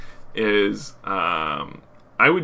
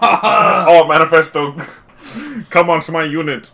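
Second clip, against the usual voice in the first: hammed-up hero call; 4 semitones higher, 7 dB louder than usual. Acted call to teammate.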